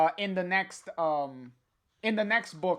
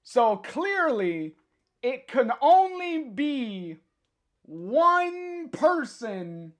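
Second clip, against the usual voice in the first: high, drawn-out reveal with long pauses; teasing suspense; 6 semitones higher, 3 dB louder, much slower than usual. Pretend. Streamer about to reveal the mysterious girl, teasing suspense. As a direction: stretch every word to keep them waiting.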